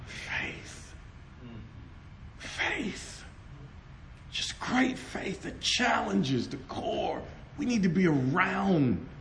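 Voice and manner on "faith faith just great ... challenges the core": whispered faith. Real, not acted. Preacher hushes on key word.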